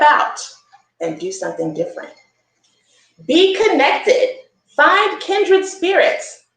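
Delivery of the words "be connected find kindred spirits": slow, bright storybook reading; reading to children. A narrator's reading. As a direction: read it to the little ones like a gift. Reading book text.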